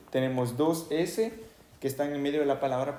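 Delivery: ordinary talk, nothing marked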